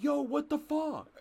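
high, loud yell at another driver; angry yell. Retold or imitated. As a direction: shout it out the car window. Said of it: "I'm like".

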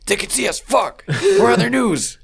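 high, panicky cry; panicked alien. Pretend. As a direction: squeal it out in alarm.

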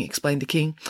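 usual speaking voice

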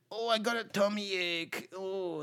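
a hint of a whiny Hitler with a tummy ache; mock whimper, light and a little soft; lightly voiced whiny Hitler. Friend mocks Hitler, a hint of whining. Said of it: "Hitler's like".

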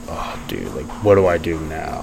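low, soft retold dismay; lightly acted, sunk and under the breath; helpless inner groan. Athlete recalling rising anxiety, quiet helpless dismay.

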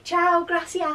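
put-on Scouse-accented tourist Italian. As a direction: say it like a Liverpudlian abroad. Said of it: "in the most Scouse accent".

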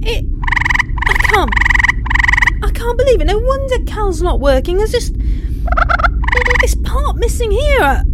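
can't believe her luck; breathless thrill at the fix; excited disbelief. Acted. Finds the missing part.

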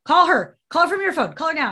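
high, loud bossy dad imitation; imitated screaming. Dad ordering daughter to call Elizabeth, mock screaming. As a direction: yell the order, starting loud and staying high. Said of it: "he's, like, screaming at her".

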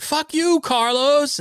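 high, drawn-out mock shout of abuse; retold outburst. Host imitating the podcaster's reply to his question, mock yelling. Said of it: "he responded, he said".

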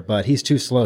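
ordinary talk

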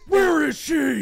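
Batman voice. Shown as a demonstration. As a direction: push the Batman growl to a shout. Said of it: "you gotta push it".

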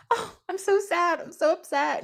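feigned upset. Retold or imitated. Isis in disguise luring Set, feigning sadness.

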